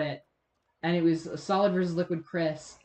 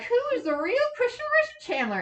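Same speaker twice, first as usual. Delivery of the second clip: high interrogator voice. Retold or imitated. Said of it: "going like".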